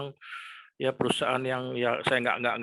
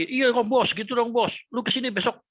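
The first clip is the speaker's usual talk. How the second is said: eager friend on phone; 9 semitones higher than usual. Retold or imitated. Friend on the phone welcoming him back, excited. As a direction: voice the buddy who says come tomorrow.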